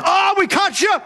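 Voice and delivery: bad guys catching Daniel praying, shouting in triumph; gotcha shout. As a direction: pounce on him with a sneering cry.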